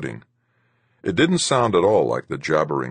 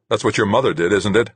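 spat; 5 semitones higher, 5 dB louder than usual. A narrator's reading. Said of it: "I spat".

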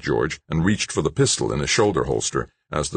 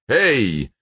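mild high shout; 8 semitones higher, 4 dB louder than usual. A narrator's reading. Gunman spots people on the stairs, mild shout. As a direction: call out sharply, higher than narration. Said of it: "a man shouted".

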